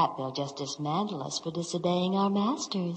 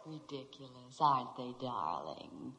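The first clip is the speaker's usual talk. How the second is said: sultry coo; 6 semitones lower, 11 dB quieter than usual. Acted. Lulu coos to Elmer.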